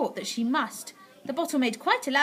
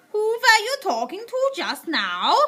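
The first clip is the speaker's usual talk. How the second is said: slow, higher, louder witch snap; witch snapping; 11 semitones higher, 6 dB louder, slower than usual. A narrator's reading. Witch snapping at grandmother, slow and sharp. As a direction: bite off each word. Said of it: "snapped the Grand High Witch".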